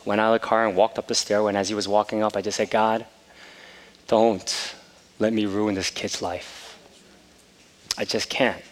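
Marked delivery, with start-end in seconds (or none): 2.7-8.6 quiet prayer. Retold or imitated. Whispered prayer for his son.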